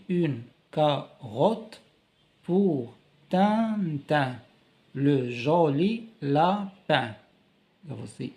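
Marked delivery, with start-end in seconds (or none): 0.1-7.2 slow reading aloud. Shown as a demonstration. Teacher reading the picture caption very slowly. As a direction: read each word out like to small children. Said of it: "عندنا l'image".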